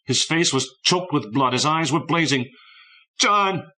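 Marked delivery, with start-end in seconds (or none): 3.2-3.7 high, loud cry of the name. A narrator's reading. Boy shouting at his departed friend, a high loud cry. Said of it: "he cried".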